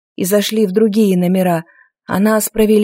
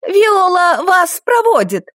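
high, slow called-out line; calling madam; 9 semitones higher, slower than usual. A narrator's reading. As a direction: call it across the lobby. Madam calls the girl.

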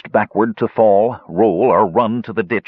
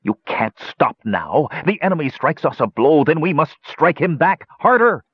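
higher, scornful commander; menacing villain; 8 semitones higher than usual. A narrator's reading. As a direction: drive them on with scorn. Villain berates his savants.

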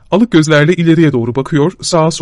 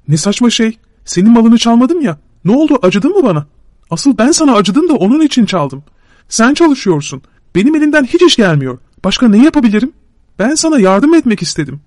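higher, aggrieved self-justifying voice; Şakro pleading; 6 semitones higher than usual. Acted. Şakro justifying the stolen silk to his friend, higher and aggrieved. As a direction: defend yourself, wounded and pitched up.

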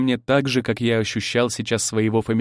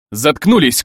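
loud, higher angry order; near-full-voice bark; 4 semitones higher, 8 dB louder than usual. A narrator's reading. Husband silencing three quarrelling brides, loud barked order. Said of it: "рявкнул я почти в полный голос".

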